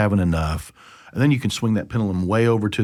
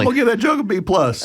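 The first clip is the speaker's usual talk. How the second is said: laughing self-grade; 3 dB louder than usual. Real, not acted. Joking self-rating.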